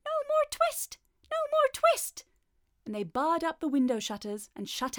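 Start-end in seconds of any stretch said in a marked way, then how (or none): 0.0-2.2 very high, louder squeaky cry; gleeful tiny chorus; twittering mouse shout. A narrator's reading. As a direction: shout together like tiny mice. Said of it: "shout at once in little twittering voices".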